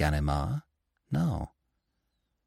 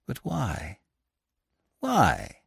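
soft baffled repeated question; utter amazement; 2 semitones higher, 4 dB quieter, much slower than usual. A narrator's reading. Lupin baffled by his rescuer, amazed. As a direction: ask in stunned disbelief. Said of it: "in utter amazement".

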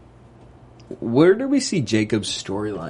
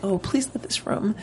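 high, soft imitation of her excitement; hushed, high-pitched retold plea; excited pleading; 8 semitones higher, 7 dB quieter than usual. Imitating her as she runs in, excited. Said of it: "she's so excited".

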